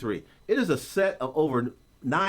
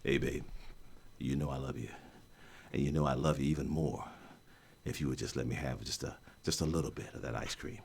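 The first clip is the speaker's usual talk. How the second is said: much lower, much softer seductive croon; 13 semitones lower, 11 dB quieter than usual. Retold or imitated. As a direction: woo her in a deep soft croon.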